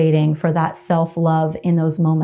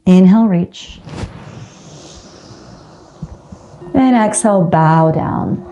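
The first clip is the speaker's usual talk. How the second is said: yoga class cueing. Acted. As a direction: call the flow to the class, each inhale and exhale on the beat. Yoga teacher cueing a flow.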